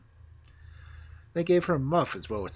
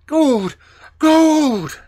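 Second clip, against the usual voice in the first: shouted, much higher and louder, rising; excited exclaiming; 15 dB louder than usual. Acted. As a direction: robbers greedily shout at the gold.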